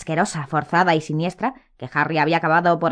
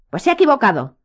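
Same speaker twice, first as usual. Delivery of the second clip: cutting, higher rebuke; sharp retort; 7 semitones higher, 3 dB louder, faster than usual. A narrator's reading. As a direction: snap back coldly.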